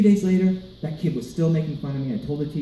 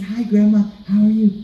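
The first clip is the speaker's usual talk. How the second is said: higher, louder greeting; English-speaking grandkid; 5 semitones higher, 5 dB louder than usual. Retold or imitated. Grandkid greeting Spanish-speaking grandma in English, loud and high. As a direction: pitch it up and speak loudly to grandma. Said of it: "I said".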